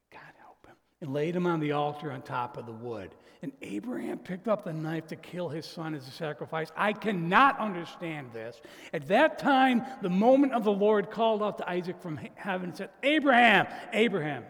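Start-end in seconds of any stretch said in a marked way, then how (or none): 0.1-0.8 whisper. Real, not acted. Aside while telling sacrifice. 8.9-14.5 calling out. Acted. Voice of the Lord calling.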